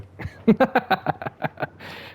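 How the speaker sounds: high, breath-driven run of laughter; long chuckle. Real, not acted. Player done with Malenia, long high laugh. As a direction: laugh it off after giving up.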